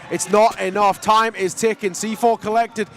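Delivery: high, loud breathless hype; excited casting. Real, not acted. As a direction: scream the clutch play. Clutch plant call.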